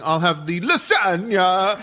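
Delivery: slow, hammy Italian order; mock Italian diner ordering lasagna; mock Italian accent. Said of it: "the Italian".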